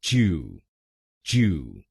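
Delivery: च्यू said alone as "chyoo"; syllable demo. Shown as a demonstration. Teacher drilling the British third syllable, said clearly. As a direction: give च्यू its own clear beat. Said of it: "हम बोलेंगे च्यू".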